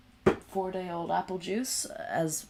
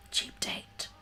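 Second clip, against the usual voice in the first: whisper; 4 dB quieter than usual. Real, not acted. Whispered self-mocking aside.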